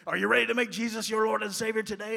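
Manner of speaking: loud, fast, high imitation of a gravelly preacher; mock gruff preacher's shout; gruff old preacher. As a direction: boom out the altar call like an old revivalist. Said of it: "his gruff and gravelly voice".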